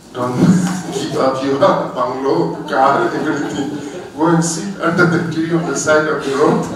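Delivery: real laugh while joking with friends. Real, not acted. Monk joking to friends about sitting under a tree, laughing. Laughing at his own joke.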